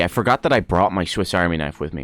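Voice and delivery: ordinary delivery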